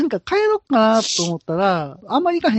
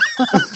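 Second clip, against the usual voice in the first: high laugh. Real, not acted. Laughs at his own pun.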